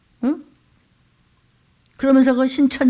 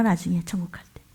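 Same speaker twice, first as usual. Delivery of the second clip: hushed, much softer aside; whisper; 5 semitones lower, 9 dB quieter, faster than usual. Real, not acted. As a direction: drop to a whisper. Aside while exhorting the congregation. (속삭이는 목소리로)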